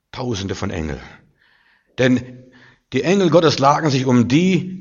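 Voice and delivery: normal talking voice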